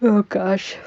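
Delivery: talking through a yawn. Real, not acted. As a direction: yawn the words out. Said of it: "Sorry for the yawning".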